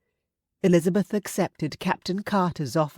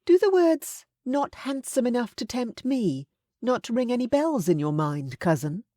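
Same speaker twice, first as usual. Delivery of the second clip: higher, firm matronly voice; stern aunt; 8 semitones higher than usual. A narrator's reading. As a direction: scold him like a frank aunt.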